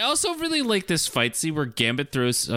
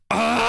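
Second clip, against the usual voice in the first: high-pitched comic scream; mock scream; 3 dB louder than usual. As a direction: imitate a frustrated scream, very high. Retelling Wanda's scream at empty cell.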